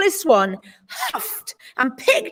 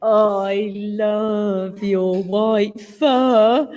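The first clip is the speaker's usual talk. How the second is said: flirty; 5 semitones lower, much slower than usual. A narrator's reading. Brown bear flirts.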